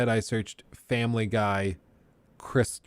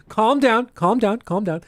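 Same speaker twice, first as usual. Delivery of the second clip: higher, urgent repeated plea; exasperated pleading; 9 semitones higher, 3 dB louder than usual. Retold or imitated. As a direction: try to rein in a raving actor. Pleading with screaming actor.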